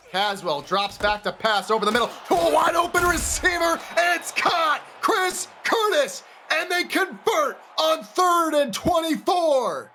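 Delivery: shouted high catch call; excited play-by-play. Real, not acted.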